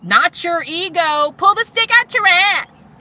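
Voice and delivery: high, loud mock heckle; defiant heckling. Heckling the church men from the congregation, high loud jeer. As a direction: yell up at the leaders to knock them down.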